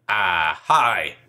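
a silly put-on voice, not his own. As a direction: greet in a fake voice. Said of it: "That's not my voice".

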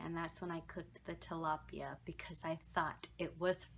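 hushed whisper. Real, not acted. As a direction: whisper it like a secret. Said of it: "whispered confession of mistake".

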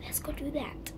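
soft unvoiced whisper. Real, not acted. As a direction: whisper it so mom won't hear. Sneaking off to read quietly.

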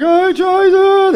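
high, slow, drawn-out mock wail of despair; crying teacher wail. Mock weepy teacher wailing to her class. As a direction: stretch it out like a weepy teacher about to break down. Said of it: "They're like".

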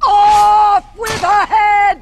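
high, loud, slow bellowed verdict; drawn-out enraged command; furious royal shout. Acted. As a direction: roar the sentence out. Queen pronounces the sentence.

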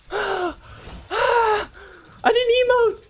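very high, screaming with laughter and sobs; ecstatic screaming. Real, not acted. Streamer celebrating his first solo win, ecstatic screaming. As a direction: scream with real joy, half laughing half crying. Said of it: "I'm freaking so excited".

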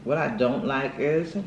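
talking while chewing; speaking around food; mouth full. Real, not acted. Seen 0: Bite at -3; cheeks full, chewing to +3; normal speech after.

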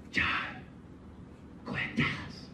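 stirring up the fight, a teasing whisper; hushed egging on. Retold or imitated. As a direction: coax him quietly to spill it. Egging friend on.

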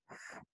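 "F" as a voiceless TH, just air; airy TH. Shown as a demonstration. Teacher demonstrating the first English TH sound, voiceless air. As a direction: let air pass softly for "F", no voice. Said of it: "Un son qui laisse passer de l'air".

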